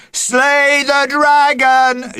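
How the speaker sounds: loud high chanting shout. Acted. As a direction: cheer it from the crowd. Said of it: "shouted the audience".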